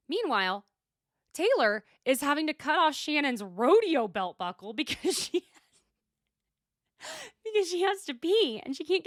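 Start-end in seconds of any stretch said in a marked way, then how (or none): 5.2-9.0 high, louder, through laughter; laughing. Real, not acted. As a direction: fight laughter while getting the story out. Cracks up telling belt buckle story.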